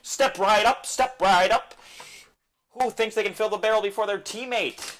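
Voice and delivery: loud, high barker's call; carnival barker. Acted. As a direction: draw the crowd like a fairground barker.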